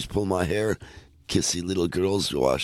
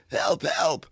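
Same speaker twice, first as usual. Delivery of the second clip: mock cry for help; 11 semitones higher than usual. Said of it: "she cried".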